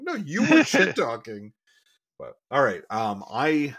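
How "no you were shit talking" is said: mock indignant. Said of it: "I'm like".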